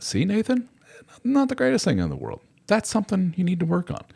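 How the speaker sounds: high mock-therapist voice; hammed-up high therapist voice; therapist impression. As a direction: play the chummy therapist up high. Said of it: "therapist continuing".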